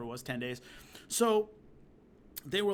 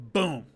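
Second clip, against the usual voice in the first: loud short "Boom!"; imitated leg kick; kick impact sound; 6 dB louder than usual. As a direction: punch the word like a kick landing.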